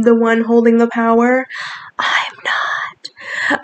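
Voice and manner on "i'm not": hushed, whispered admission. Acted. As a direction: whisper it as a quick confession. Whispered admission in performed poem.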